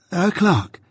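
a lightly voiced young man repeating eagerly.

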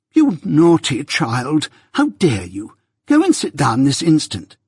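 high, emphatic telling-off; indignant scolding. A narrator's reading. Missus Pocket scolding Jane for taking the nutcrackers, indignant. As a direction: scold a child with crushing dignity. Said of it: "Missus Pocket's dignity was so crushing".

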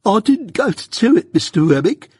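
high, pleading, near-tearful client; pleading Mike. A narrator's reading. Mike pleads with Wemmick not to scold, high and pleading. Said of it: "pleaded Mike".

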